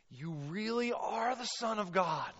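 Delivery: high, emphatic declaration of awe; worshipful exclamation. Acted. Preacher as disciples worshiping Jesus in the boat, worshipful exclamation. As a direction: proclaim it with rising fervor. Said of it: "the disciples worshiped him".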